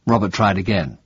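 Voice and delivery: ordinary delivery, nothing marked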